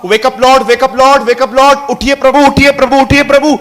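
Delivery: loud, high urgent cry to wake him; loud imploring wake-up call; panicked calling out. Retold or imitated. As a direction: disciples shouting to wake their Lord. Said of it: "उसके चेलों ने कहा".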